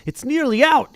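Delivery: an eager announcement the egg is hatching; excited Hagrid. Acted. Hagrid greets them, excited.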